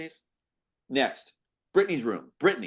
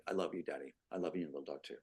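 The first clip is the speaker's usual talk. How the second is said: tender; 8 semitones lower, 12 dB quieter, faster than usual. Acted. Father and daughter bedtime game.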